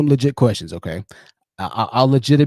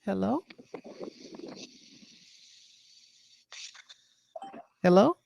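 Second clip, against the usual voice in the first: high, soft questioning hello; put-on girl voice; 6 semitones higher, 12 dB quieter than usual. Streamer answering a caller as a girl, put-on high voice. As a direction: answer the phone in a higher, girlish pitch.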